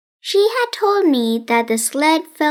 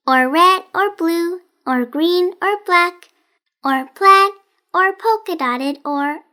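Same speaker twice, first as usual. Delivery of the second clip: higher and louder, listing breathlessly; odd girl Rosamond, rattling on; 4 semitones higher, 3 dB louder than usual. Acted. Rosamond lists what the present might be, higher and louder. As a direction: pile up the options faster and faster.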